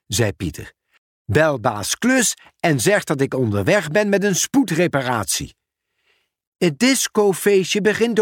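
friendly hurried exchange; character dialogue. Acted.

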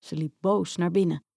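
usual speaking voice

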